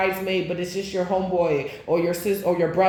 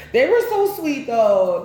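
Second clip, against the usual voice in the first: gushing fondly; 5 semitones higher, 5 dB louder, slower than usual. Real, not acted. Fond recollection of old people.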